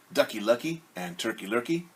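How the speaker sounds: usual speaking voice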